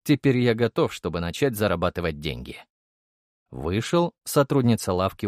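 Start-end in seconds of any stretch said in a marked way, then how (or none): none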